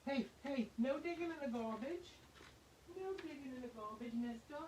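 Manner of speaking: high, sing-song scolding of the pet. Real, not acted. Owner telling her pet off for digging in the garbage, high and sing-song. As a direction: tell the pet off gently in a high voice.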